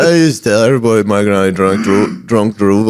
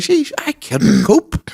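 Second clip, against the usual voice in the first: put-on very high, slow old-lady sigh; weary granny; much slower than usual. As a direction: drag it out like an exhausted granny. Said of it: "She's like".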